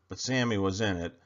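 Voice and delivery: ordinary delivery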